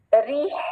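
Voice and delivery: रीह ending in an audible breath; long ee closing on breathy h; mid-throat h. Shown as a demonstration. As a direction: release the h of रीह from the middle of the throat. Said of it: "ये वसतुल हलक वाली हा है".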